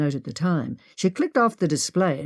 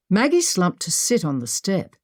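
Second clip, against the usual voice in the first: usual speaking voice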